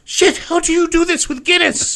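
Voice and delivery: very high, loud Irish brogue, laughing; laughing Irish protest; Irish drunk. Retold or imitated. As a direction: squeal it in disbelief like a drunk Irishman. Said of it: "It was".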